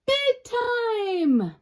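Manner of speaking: high squealing panic; panicked high cry. Acted. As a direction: shriek it out in fright. Spider freaks out.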